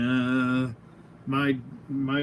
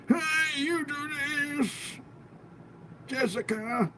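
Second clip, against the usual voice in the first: put-on old man, pitched higher and softer; playful higher old man voice for the angry toy head; 12 semitones higher, 5 dB quieter than usual. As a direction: whine it like a wounded old man. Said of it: "I'm doing old man voice right now".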